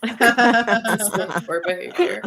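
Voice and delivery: slow words through laughter; laughing. Real, not acted. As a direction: laugh while saying it slowly. Laughing after teacher story.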